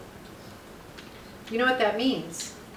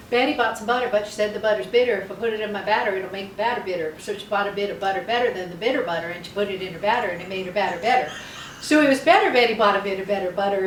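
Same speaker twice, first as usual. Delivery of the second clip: tongue twister; 3 dB louder than usual. Shown as a demonstration. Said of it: "you can't say that".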